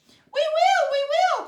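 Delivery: very high, loud squeaking chant; a crowd of mice answering eagerly; shrill mouse chorus. A narrator's reading. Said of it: "cried all the mice in a shrill chorus".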